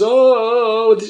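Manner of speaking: long, high "oh" of alarm; startled group cry. Retold or imitated. Anglers watching a shark hang overhead, startled drawn-out cry. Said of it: "we're kinda going like this".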